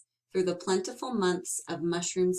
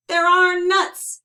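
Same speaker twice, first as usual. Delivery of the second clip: much higher and louder cry; exclaimed; 10 semitones higher, 11 dB louder than usual. Acted. Bruce exclaiming after the vanishing cone. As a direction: call out in alarm. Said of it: "exclaimed Bruce".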